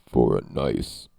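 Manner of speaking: very low, slow, loud announcer voice; deep, drawn-out sleazy ad read; mock ad voice.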